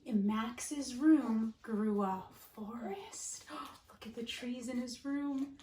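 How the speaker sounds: hushed, wondering whisper. Acted. Teacher to her class, awed whisper. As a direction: whisper it like a secret discovery.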